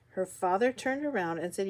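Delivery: plain reading voice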